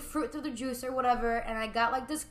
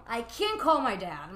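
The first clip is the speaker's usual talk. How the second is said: ordinary talk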